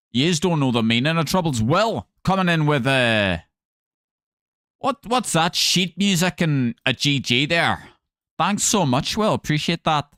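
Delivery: a light Northern Irish accent; Northern Irish voice, rising at the ends. Shown as a demonstration. Streamer keeps up his Northern Irish accent for chat. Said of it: "my Northern Irish accent's pretty good".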